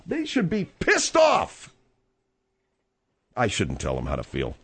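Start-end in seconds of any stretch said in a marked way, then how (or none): 0.1-1.7 outraged. Real, not acted. Outraged at family statement.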